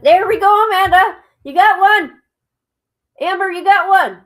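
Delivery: high, loud happy cheer; excited cheer. Real, not acted. Streamer cheering viewers Amanda and Amber for winning. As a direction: celebrate them out loud.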